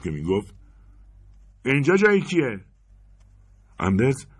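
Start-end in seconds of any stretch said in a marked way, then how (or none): 1.6-2.6 high, loud questioning of the tellers; gruff demand. Acted. Robber asking whose empty window this is, loud and demanding. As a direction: demand an answer now.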